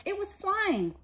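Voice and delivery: bright and high, just remembered; sudden wonder. A narrator's reading. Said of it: "remembering suddenly".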